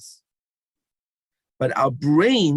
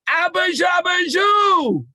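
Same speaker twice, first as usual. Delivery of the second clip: full shout, far higher and louder; with even more energy; 3 dB louder, slower than usual. Shown as a demonstration. Leader whipping up the whole crowd, maximum shout. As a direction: scream it with every ounce of energy. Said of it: "with even more kayach and more energy".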